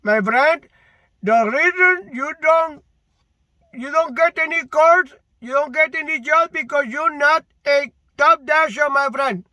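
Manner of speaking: put-on Indian accent. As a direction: imitate the Indian agent lightly. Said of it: "She said it in Indian accent".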